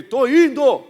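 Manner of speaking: high loud reply; shouting back. Retold or imitated. Friend shouting back to pastor from afar, loud. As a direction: holler that you're on your way.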